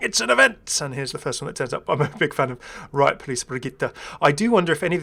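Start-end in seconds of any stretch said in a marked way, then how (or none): 0.0-0.8 high, loud game-announcer cheer; mock fanfare of a game splash screen; excited announcement. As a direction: sell it like a splashy game banner. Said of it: "the splash screen saying".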